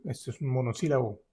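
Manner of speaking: ordinary delivery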